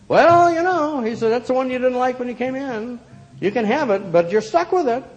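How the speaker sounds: put-on high, loud old shopkeeper voice; an old shopkeeper gently warning her. A narrator's reading.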